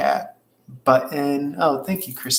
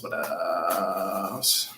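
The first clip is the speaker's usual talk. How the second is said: low, softened and stretched out; drawn-out musing; 7 semitones lower, 6 dB quieter than usual. Real, not acted. Host pondering what the reading shows next, drawn-out musing. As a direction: sink low and draw the words out.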